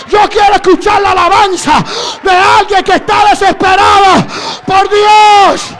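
fervent shouted exhortation; preaching at full force. Acted. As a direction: rouse the congregation, crying out.

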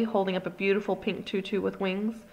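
usual speaking voice

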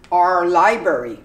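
"Our" as a short R sound; r-colored our. Shown as a demonstration. Teacher modelling "Our" for learners. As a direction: drill it, "Our" without the O U. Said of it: "You do completely without the O U".

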